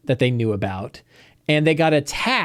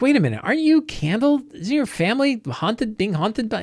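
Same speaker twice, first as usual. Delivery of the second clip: sudden recognition; 7 semitones higher than usual. Retold or imitated. Realizes who Candle is.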